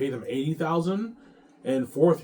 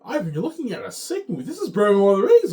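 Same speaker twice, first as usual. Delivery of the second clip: excited Peter; 9 semitones higher, 9 dB louder than usual. Retold or imitated. Ivan voicing excited Peter.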